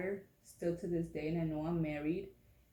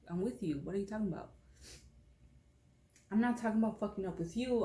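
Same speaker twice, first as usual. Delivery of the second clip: ordinary talk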